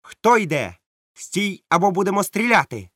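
high, loud sentry challenge; captain shouting a warning to the pirates; shouted challenge. Acted. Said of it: "він крикнув розбійникам".

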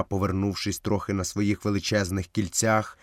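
plain reading voice

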